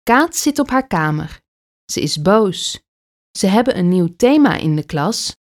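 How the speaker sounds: plain reading voice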